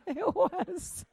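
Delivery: high, laugh-filled insistence. Real, not acted. Insisting to the church it was true, laughing. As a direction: squeeze it out through laughter. Laughing at her own story.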